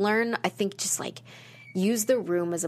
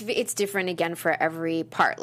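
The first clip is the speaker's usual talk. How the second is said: ordinary talk, nothing marked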